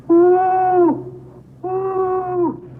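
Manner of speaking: high, hooting owl call, held long; owl imitation. [name] hooting to thrill the boys outside, owl hoot. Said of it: "do my owl imitation".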